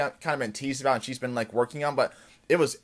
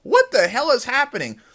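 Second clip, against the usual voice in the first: high loud panicked question; startled and scared as the crowd turns; high loud mock panic; alarmed disbelief; 11 semitones higher, 7 dB louder than usual. Adebayo chased by a mob.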